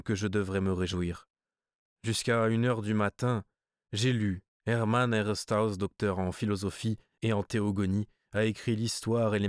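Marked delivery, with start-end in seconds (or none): none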